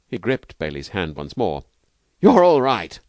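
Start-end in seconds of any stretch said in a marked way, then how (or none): 2.2-3.0 high, loud, elated praise; overjoyed. Acted. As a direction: exclaim it in grateful delight. Overjoyed Kirk's parting handshake.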